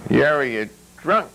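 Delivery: high indignant splutter. A narrator's reading. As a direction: sputter out the protest. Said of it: "he spluttered".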